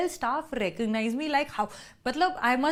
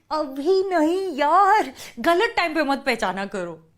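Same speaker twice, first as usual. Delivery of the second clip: exasperated outburst; 3 semitones higher, 5 dB louder than usual. Retold or imitated. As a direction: raise your voice, fed up at being recognized. Annoyed at being recognized mid-bargain.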